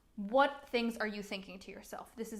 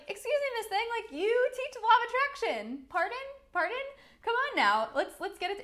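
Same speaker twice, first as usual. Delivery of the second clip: much higher, sassy Miss Thing voice; sassy mock scolding, pitched way up; sassy call-out; 11 semitones higher, 4 dB louder than usual. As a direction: tease her, loud and high. Sassy challenge to client.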